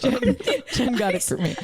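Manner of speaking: giggling while talking; laughing. Real, not acted. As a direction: laugh through the words. Laughing together.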